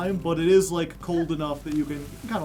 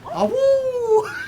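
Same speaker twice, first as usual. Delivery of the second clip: high, loud little howl; howling like a wolf pup; 7 dB louder than usual. Pretend. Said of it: "are you ready for the best voice line?".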